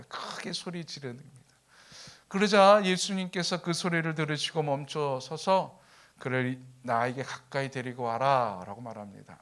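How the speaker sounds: usual speaking voice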